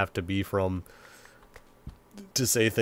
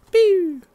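a high, loud cartoon pew; mock jump sound effect; 10 dB louder than usual. Gamer jumping toward the house, playful high loud pew.

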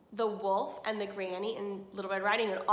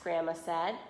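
ordinary delivery, nothing marked

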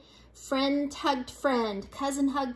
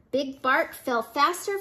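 usual speaking voice

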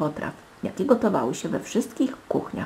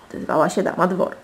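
ordinary delivery

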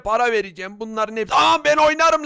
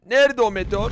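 ordinary talk, nothing marked

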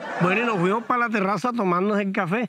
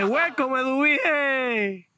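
high, slow drawn-out greeting; greeting call; 5 semitones higher, much slower than usual. Retold or imitated. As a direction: call her name out long and high. Saludo cantado a la comadre. (saludo alargado y agudo)

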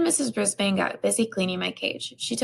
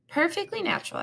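a hint of a squeaky hamster echo; hamster repeating; 9 semitones higher than usual. A narrator's reading. Hamster echoing the teacher to the class, lightly squeaky. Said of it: "I repeated".

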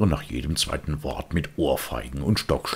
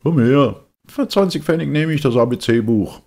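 loud, hawking street call; a ragman hollering from his cart; booming trader's shout; 12 semitones higher, 9 dB louder than usual. Acted. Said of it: "rief ein Lumpenmann".

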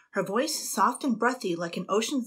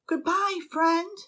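much higher, fond farewell; calling out; 10 semitones higher, slower than usual. A narrator's reading. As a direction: call sweetly after it. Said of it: "Annabel called out".

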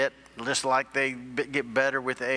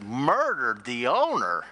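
slow, swooping incredulous echo; hammed-up disbelief with big pitch swoops; mock shocked innocence; slower than usual. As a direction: act astonished at the accusation, stretching each word. Woman feigns shock at accusation.

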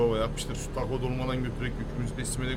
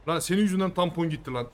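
angry; 5 semitones higher, 4 dB louder than usual. Real, not acted. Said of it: "Çok sinirlendim".

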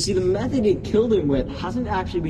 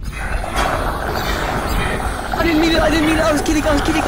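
panicked squeal; 12 semitones higher than usual. Pretend. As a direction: squeal in fake terror. Panics after summoning something scary.

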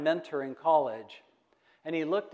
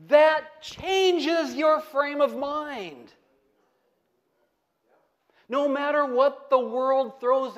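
high, loud, ringing preacher's climax; full-throated revival preaching; preaching at full force; 7 semitones higher, 6 dB louder than usual. Acted. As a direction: lift the whole room with it, then slow right down.

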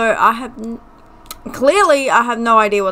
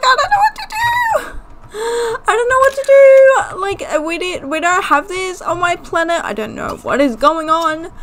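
mock panic; 7 semitones higher, faster than usual. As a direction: play a freaked-out alien dad, squeaky at first. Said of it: "stop freaking out".

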